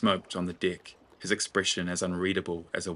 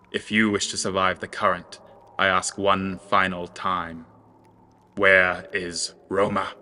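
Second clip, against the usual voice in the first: menacing final demand; 7 dB louder than usual. Acted. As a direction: drag out the last question like a warning. Final threat to Magwild.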